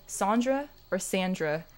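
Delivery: first "Sandra" as SAHN-dra, second "Sandra" as SAN-dra; AH in first "Sandra", short A in second "Sandra"; two pronunciations. Shown as a demonstration. Streamer weighing aunt's name, SAHN-dra then SAN-dra for "Sandra". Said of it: "I used to say Sandra".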